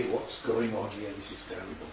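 a hushed, appalled crowd voice; hushed dismay. Retold or imitated. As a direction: murmur like a shocked onlooker. Crowd watching the crucifixion.

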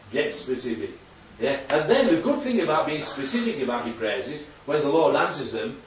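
ordinary talk, nothing marked

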